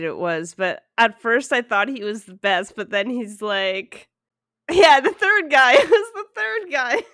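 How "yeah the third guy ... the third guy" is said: high, loud, laughing outburst; excited realization. Real, not acted. Viewer suddenly remembers which candidate, excited. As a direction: blurt it out, louder and higher.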